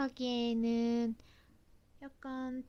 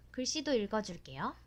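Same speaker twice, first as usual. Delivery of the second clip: ordinary talk, nothing marked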